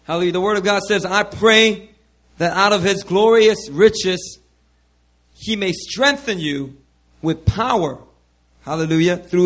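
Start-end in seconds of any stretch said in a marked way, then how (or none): none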